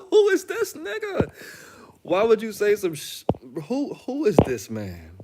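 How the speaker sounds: high, loud incredulous cry; disbelief. Real, not acted. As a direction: shout it in total disbelief. Incredulous at his own line.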